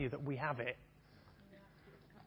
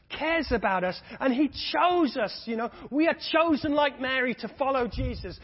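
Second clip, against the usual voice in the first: loud, higher, climbing sermon fervour; preaching at full force; 6 semitones higher, 7 dB louder than usual. Acted. Preacher rousing the congregation to never give up, loud and soaring. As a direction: preach it out to the whole church.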